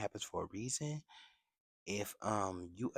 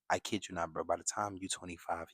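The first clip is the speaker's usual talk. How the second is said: normal talking voice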